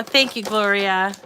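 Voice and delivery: normal talking voice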